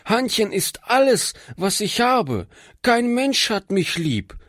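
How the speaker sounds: put-on high, pleading furious girl voice; flood of angry words. A narrator's reading. Girl protests to the preacher, in a high angry flood of words. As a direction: pour out hurt and anger. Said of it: "brach in eine Flut zorniger Worte aus".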